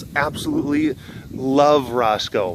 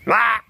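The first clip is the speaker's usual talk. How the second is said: short, high imitation meow; half-dead little cat cry; drunken cat squeak; 8 semitones higher than usual. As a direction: squeak out a sick cat's meow. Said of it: "drunk version of a Cassie squeak".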